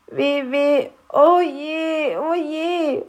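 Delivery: a small goblin despairing at a name; dismayed cry. A narrator's reading. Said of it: "rief Knöterchen". (klagender Ausruf, höher)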